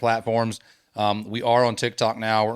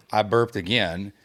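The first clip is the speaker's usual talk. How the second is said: ordinary talk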